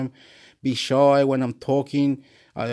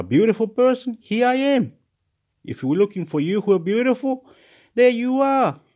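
much higher, mock-grand announcement; playful high proclamation; 10 semitones higher, 4 dB louder than usual. Vlogger presenting self to the world, high and proclaiming. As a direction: present yourself in a high, lifted voice.

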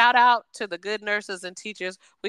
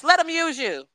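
churchy encouragement; 4 semitones higher than usual. Retold or imitated. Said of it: "I'm be like".

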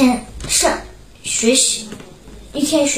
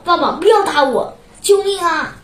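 crying out; 6 semitones higher than usual. A narrator's reading. Son begging father not to hit him, crying out. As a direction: call out in mild storybook alarm. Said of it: "我大喊道".

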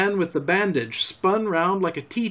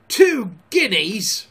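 slow, high, loud roar of scorn; outraged, drawn-out bellow; 5 semitones higher, 5 dB louder, slower than usual. A narrator's reading. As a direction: roar it, stretching each word. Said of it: "roared Mary".

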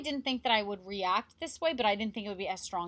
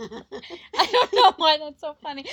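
giggling through the words; laughing while talking; 5 semitones higher, 8 dB louder than usual. Real, not acted. Laughing at own joke.

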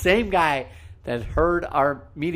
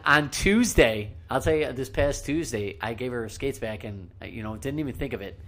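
ordinary talk

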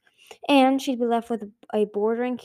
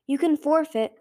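higher-pitched urgent cry; 6 semitones higher, 3 dB louder than usual. A narrator's reading. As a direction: call out to her, pitch raised. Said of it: "Sunny cried".

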